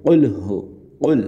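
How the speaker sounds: উল as "qul", the q from deep inside; deep Qaf. Shown as a demonstration. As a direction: hit উল from the back of the throat. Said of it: "এরকম".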